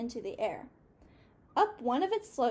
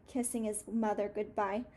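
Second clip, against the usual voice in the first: ordinary delivery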